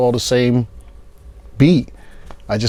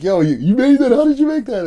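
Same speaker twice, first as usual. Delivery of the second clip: amazed friend; 11 semitones higher than usual. Retold or imitated.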